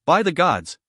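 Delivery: mild cry of disbelief; understated disbelief. A narrator's reading. Soldier seeing a terrifying sight at the tower, mildly disbelieving. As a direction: exclaim in restrained disbelief. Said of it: "The cry of disbelief".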